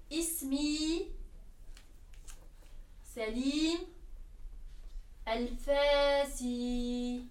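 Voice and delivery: very drawn-out Arabic model phrase; long pauses between words, last syllables stretched; slow teacher modelling. Shown as a demonstration. Teacher repeats an Arabic introduction for learners, slow and drawn out.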